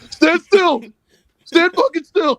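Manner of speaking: high, loud mock commands; mock shouting. Wrestlers storming in on Tony, in high mock shouts. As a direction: yell it like a cop bursting in.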